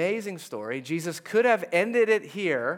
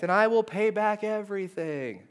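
begging; 6 semitones higher than usual. Acted. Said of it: "he begged".

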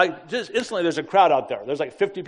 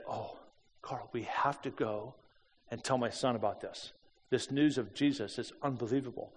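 hushed plea; 4 semitones lower, 11 dB quieter than usual. Retold or imitated. Shop owner's plea, retold late night.

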